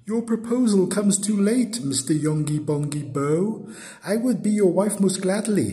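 high-pitched, mildly regretful lady; Lady Jingly. A narrator's reading. Lady answers her suitor's proposal, mildly sad. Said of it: "answered sadly, and her tears began to flow".

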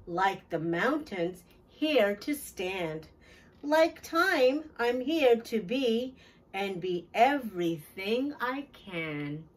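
ordinary delivery, nothing marked

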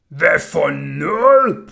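very loud, slow, drawn-out roar. A narrator's reading. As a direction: bellow it in angry disbelief. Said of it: "brølede smeden".

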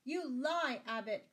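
high, loud indignant accusation; crying out. A narrator's reading. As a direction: cry out in protest at the insult. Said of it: "cried Sir Richard".

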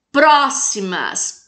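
"Próximas" slow, long strong first syllable "Pró"; stressed antepenultimate syllable. Shown as a demonstration. Teacher giving a proparoxytone example, "Pró" stressed in "Próximas". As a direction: hit and stretch "Pró" in "Próximas". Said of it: "acentuadas na antepenúltima sílaba".

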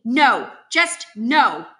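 loud, slow roar of refusal. Acted. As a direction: roar it, each word drawn out. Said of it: "my mother roars".

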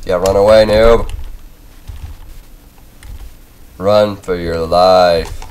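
loud taunt at a fleeing foe; mock him as he runs. Real, not acted.

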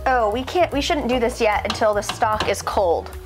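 ordinary talk

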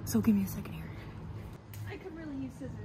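careful whisper; hushed and focused. Real, not acted. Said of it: "trying to be really careful".

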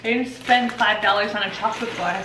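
ordinary talk

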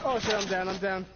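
urgent shouted callout; alarm shout. Real, not acted. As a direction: call out being downed. Player knocked down in game.

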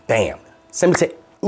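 ordinary delivery

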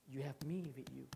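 whispered, unvoiced and intimate. Preacher voices God telling the struggler he is near, whispered. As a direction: breathe it as a secret promise. God's words repeated softly.

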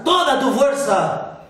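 "toda tu fuerza" called out high and loud; shouting. A narrator's reading. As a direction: yell "toda tu fuerza" as an order. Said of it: "su maestro le gritó".

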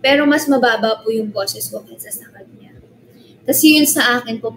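ordinary talk

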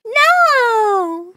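high, loud, drawn-out no; indignant yell. Real, not acted. Streamer protesting being called stupid, indignant yell. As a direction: shout the protest and stretch it out.

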